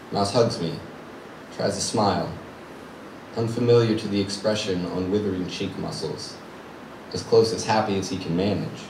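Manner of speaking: quietly grieving; subdued sorrow. Acted. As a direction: let it sink to a hushed, sorrowful telling. Sorrowful sight of wasted friend's smile.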